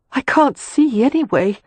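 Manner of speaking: Catherine, hurt. A narrator's reading. To Leo, whom she overheard, a high hurt accusation. Catherine edging along the sill.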